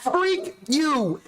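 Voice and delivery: high squeaky edited-cuss voice; censored TV dub voice. Retold or imitated. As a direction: squeal it like a bleeped TV edit.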